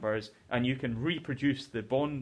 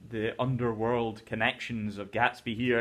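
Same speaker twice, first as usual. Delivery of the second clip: ordinary delivery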